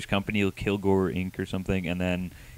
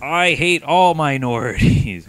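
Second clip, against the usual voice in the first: mock confession; 6 semitones higher, 6 dB louder, slower than usual. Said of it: "one guy's like".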